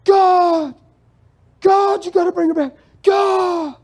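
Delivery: high, loud cry to God; screaming and ranting. Acted. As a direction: scream it out like a desperate husband pleading with God. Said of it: "screaming and ranting and raving".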